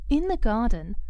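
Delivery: ordinary delivery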